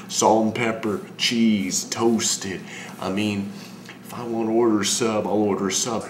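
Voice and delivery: loud, irritated customer rant; acted annoyance at full volume; exasperated. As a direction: raise your voice, fed up with the questions. Exasperated rant at sub shop questions.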